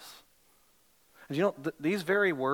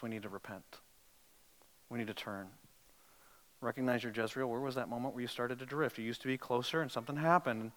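quiet altar-call plea; tender appeal; 4 semitones lower, 4 dB quieter than usual. Acted. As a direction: invite them gently to come back.

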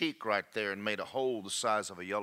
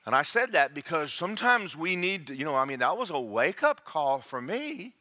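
rising, slower preaching cadence; 5 semitones higher, 3 dB louder, slower than usual. Acted. Preacher to congregation, rising into fervent preaching. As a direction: preach it with building fervor.

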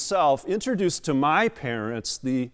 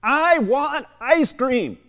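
put-on loud, high, drawn-out toddler demand; mock a screaming three-year-old, stretching every word; slow high yell, playing a little girl; imitated child's scream; 10 semitones higher, 4 dB louder, slower than usual. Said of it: "screaming at the top of her lungs".

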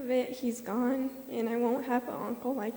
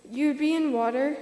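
ordinary talk, nothing marked

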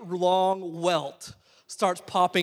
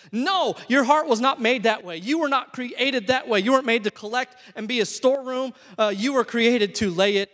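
louder, higher preaching push; forceful; 5 semitones higher, 6 dB louder than usual. Real, not acted. Preacher rejecting the heart as a storeroom, forceful. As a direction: drive it home from the pulpit.

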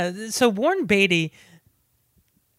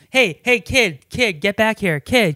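loud, high barking detective calls; Warren Beatty's Dick Tracy; 4 semitones higher, 6 dB louder than usual. Retold or imitated. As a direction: shout after the kid like an old cop. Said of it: "his voice doesn't sound like Dick Tracy".

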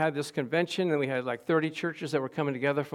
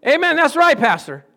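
loud, much higher call-out; eager amen; 9 semitones higher, 12 dB louder than usual. Retold or imitated. Woman in the front row cheering the speaker, loud amen. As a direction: shout agreement from the front row. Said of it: "Mary Ann's in the front row".

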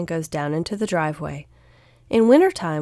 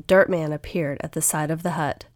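ordinary delivery

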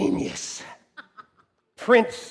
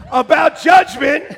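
emphatic announcement; 8 semitones higher, 11 dB louder than usual. Real, not acted. Emphatic slide read.

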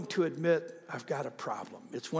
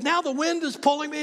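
high, loud cry of clinging on; acted fright; 12 semitones higher, 6 dB louder than usual. Skydiver clinging to the plane door, acted fright. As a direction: play the terror high and loud. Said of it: "I'm scared to death".

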